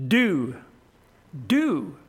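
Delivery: "do" set apart and drawn out; "do" said alone, with deliberate weight; stress on "do". Shown as a demonstration. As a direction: land firmly on the word "do". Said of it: "puts a lot of emphasis".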